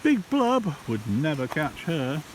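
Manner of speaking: ordinary delivery, nothing marked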